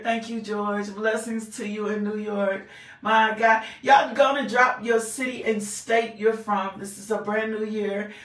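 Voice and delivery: ordinary talk, nothing marked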